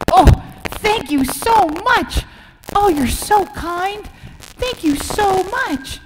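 high, slow thankful voice; grateful duck. Acted. Rescued duck thanks the donkey slowly and high. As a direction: gush your thanks slowly.

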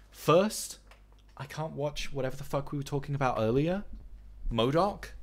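normal talking voice

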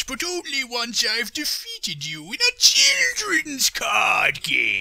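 villain shout. Acted. Villain's dramatic threat.

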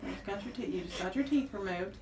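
usual speaking voice

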